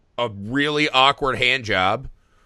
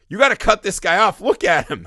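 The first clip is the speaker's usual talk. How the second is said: retold urgent plea, higher and louder; retold urging; 6 semitones higher, 4 dB louder than usual. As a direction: replay pushing the bartender to stop serving. Urging the bartender to cut off.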